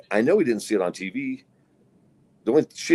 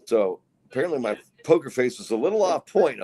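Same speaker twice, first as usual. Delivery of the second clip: ordinary delivery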